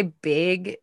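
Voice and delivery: "big" for bag, drawn out slowly; Minnesota bag. Shown as a demonstration. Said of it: "I say big".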